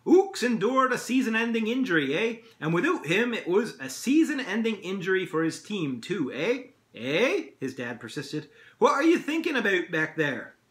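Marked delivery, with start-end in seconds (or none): 0.0-7.5 high, hammed-up Canadian accent; mock-Canadian dad voice; fake Canadian accent. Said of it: "putting on a fake Canadian accent". 8.8-10.6 lightly hammed Canadian accent, high and loud; a touch of fake Canadian. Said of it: "putting on a fake Canadian accent".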